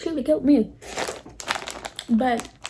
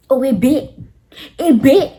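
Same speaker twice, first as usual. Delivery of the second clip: loud insistence; 3 semitones higher, 9 dB louder than usual. Retold or imitated. Telling the viewers the mouse bit, loud and insistent.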